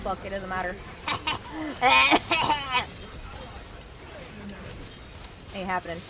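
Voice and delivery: usual speaking voice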